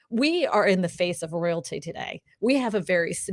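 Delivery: normal talking voice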